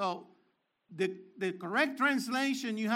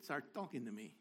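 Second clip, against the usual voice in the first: watch voice; 11 semitones lower, 14 dB quieter than usual. Retold or imitated. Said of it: "the watch said".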